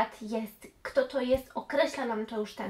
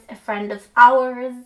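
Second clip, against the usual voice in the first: "ours" drawn out slowly, much louder than the rest; stress on ours; 13 dB louder, slower than usual. Shown as a demonstration. Teacher drilling students, loudly stressing "ours". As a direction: hit "ours" hard so it's not "our". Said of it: "Nie our, tylko ours".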